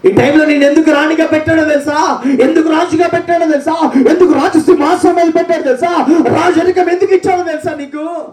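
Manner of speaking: high, fervent preacher's cry; preaching at full force. Acted. Preacher exhorting the congregation to praise, high. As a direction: drive it home to the church.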